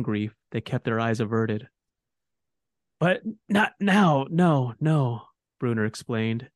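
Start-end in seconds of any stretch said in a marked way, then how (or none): 3.0-5.3 slow weary dwarf. A narrator's reading. Dying dwarf talks.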